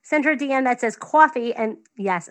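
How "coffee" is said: 'coffee' with a New York vowel, open aw sound; New York coffee. Real, not acted. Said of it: "that's the way I say coffee".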